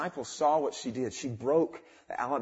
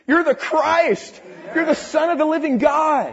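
excited confession; 11 semitones higher, 10 dB louder than usual. Acted. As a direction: proclaim it with awe, louder and higher. Peter's confession voiced.

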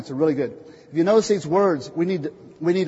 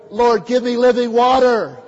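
loud declaration; 6 semitones higher, 8 dB louder than usual. Shown as a demonstration. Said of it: "Let's all declare".

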